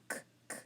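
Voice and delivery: "k" as a soft voiceless click, no vowel; isolated k sound. Shown as a demonstration. Teacher sounds out cow for pupils, isolated k sound. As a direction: click out "k" with breath only. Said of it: "how would I spell cow?".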